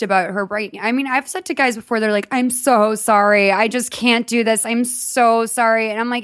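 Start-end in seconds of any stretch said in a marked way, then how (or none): 2.3-5.9 slow, drawn-out, overdone apology; mock apologetic. Guys breaking up with her, mocked as slowly over-apologetic. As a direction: stretch each word in mock remorse.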